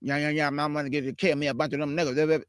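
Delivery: mock mumble rapper voice; mocking mumbler. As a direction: mumble it like you can't talk. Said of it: "You sound like mumble rappers".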